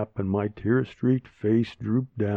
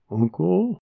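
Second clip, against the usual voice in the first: put-on child voice; 7 semitones higher than usual. Child timidly calls uncle.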